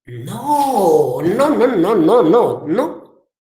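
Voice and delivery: long wailed no, then a wavering string; mock-dismayed father's string of noes; acted exasperated no. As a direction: draw out the first no, then let the rest bounce up and down. Father corrects son's misunderstanding.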